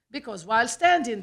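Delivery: ordinary delivery, nothing marked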